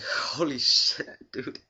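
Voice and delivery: drawn-out stunned relief; relieved amazement. Retold or imitated. Relief after cops leave.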